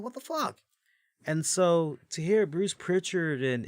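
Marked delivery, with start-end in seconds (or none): 0.0-0.6 high, indignant cry of disbelief; furious outburst. Retold or imitated. Host reliving his teen anger at the title loss, in a high indignant cry. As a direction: blurt it out in outrage. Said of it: "I was pissed".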